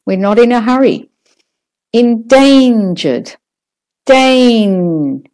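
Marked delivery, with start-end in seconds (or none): 1.9-5.2 "endangered" with DANE stretched like a rubber band; stretched stressed syllable. Shown as a demonstration. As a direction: take time on "Dane", longer and stronger. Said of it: "stretch it out a little bit like a rubber band".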